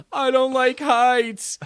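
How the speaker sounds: high, loud, drawn-out cartoon wail; slow mock-panicked cry; frightened car. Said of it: "he's like".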